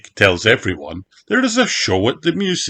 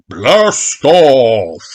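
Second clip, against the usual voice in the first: loud announcer call; booming; 5 semitones higher, 6 dB louder than usual. A narrator's reading. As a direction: boom it like a countdown voice. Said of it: "a voice booms".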